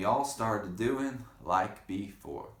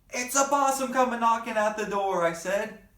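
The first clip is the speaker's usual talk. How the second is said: high, loud excited cry; excited shout; 12 semitones higher, 9 dB louder than usual. Acted. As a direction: shout the news to the family. Child shouts discovery.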